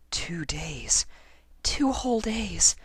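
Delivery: high, breathless whisper; anxious whisper. A narrator's reading. Lyra alone, counting days, whispering. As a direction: whisper it to herself, fretting. Lyra's anxious private thought.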